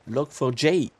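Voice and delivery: "Log four J" in an English accent, J as "jay". Shown as a demonstration. Streamer showing the chat the English way, English accent. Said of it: "À l'anglaise".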